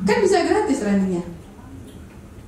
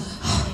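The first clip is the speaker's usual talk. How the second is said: audible breath; 7 dB quieter than usual. Shown as a demonstration. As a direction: demonstrate a speaker's noisy breath intake. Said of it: "kedengeran nafasnya". (contoh tarikan napas yang terdengar)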